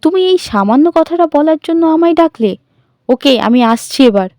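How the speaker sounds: high, loud exasperated outburst; very angry. A narrator's reading. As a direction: lash out, furious. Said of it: "Sharmistha এবার খুব রেগে যায়". (খুব রাগত গলায়)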